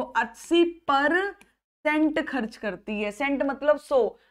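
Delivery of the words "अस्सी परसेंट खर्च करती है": slow, deliberate repeat. Shown as a demonstration. Teacher to students, very slow repeat of अस्सी percent. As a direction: say अस्सी परसेंट खर्च करती है very slowly, each word spaced. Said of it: "एक बार और बोल के देखो".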